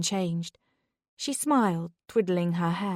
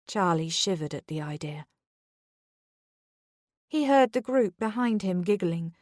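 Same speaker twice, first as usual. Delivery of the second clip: usual speaking voice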